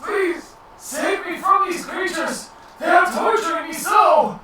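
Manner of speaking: high, loud pleading cry; desperate plea. Acted. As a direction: beg for rescue in panic. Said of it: "he looks to you and he goes".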